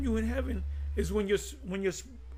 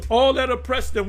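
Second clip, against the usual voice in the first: emphatic preaching; 3 semitones higher, 7 dB louder than usual. Real, not acted. Preaching echo of scripture.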